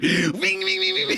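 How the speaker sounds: high-pitched motorbike revs; whiny, repeated engine noise; imitated engine revving. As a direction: mimic a bike revving over someone. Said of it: "like, revving the bike".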